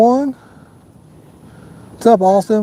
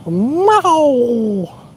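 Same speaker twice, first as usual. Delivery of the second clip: meow; 8 semitones higher than usual. Acted. Joke cat noise about catfish.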